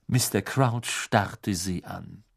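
usual speaking voice

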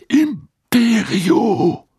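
slow, drawn-out incantation; casting a spell. Acted. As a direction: draw the spell word out slowly.

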